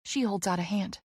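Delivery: plain reading voice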